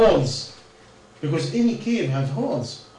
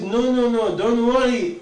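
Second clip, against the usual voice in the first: higher and louder, firmly calming them; loud reassurance; 6 semitones higher, 5 dB louder than usual. Acted. Musa calming his frightened followers, raised and firmly reassuring. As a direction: insist there is nothing to fear.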